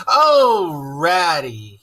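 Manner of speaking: a wailing cry of despair; crying out in misery; crying dismally. Acted. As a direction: break into a high sob on Oh. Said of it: "he cried dismally".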